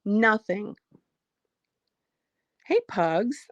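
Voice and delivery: ordinary delivery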